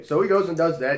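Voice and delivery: normal talking voice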